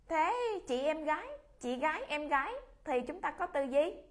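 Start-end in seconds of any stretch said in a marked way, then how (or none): none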